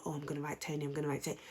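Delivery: hushed, sneaky whisper of a tattler; mock whisper. Whispering to Helena to tell on her. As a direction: whisper it like telling on someone. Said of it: "Blue was so quick to say to Helena".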